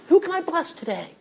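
a bright hopeful question to yourself; imagined servant wondering whom to bless; eager inner voice. Retold or imitated. Voicing eager servant's thought.

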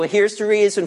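ordinary delivery

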